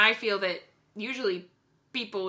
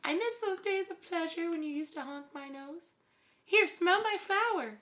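high wistful clown; 9 semitones higher than usual. Pretend. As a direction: play a sad silly clown. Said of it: "what's wrong with my clown voice?".

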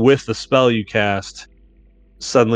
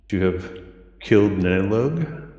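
deep echoey dragon voice. Acted. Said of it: "In this sort of deep, echoey voice".

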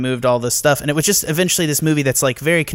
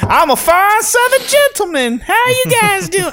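hammed-up Southern gentleman drawl, much higher and louder; bad Southern accent; 5 dB louder than usual. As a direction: play a fine Southern gentleman with a clumsy Southern accent. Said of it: "Jack can't do accents".

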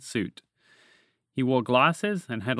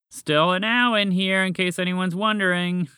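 calling out; 6 semitones higher, 4 dB louder than usual. A narrator's reading. Frog stuck inside a bush, calling out. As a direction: call out to be noticed. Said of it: "Walter's voice called out".

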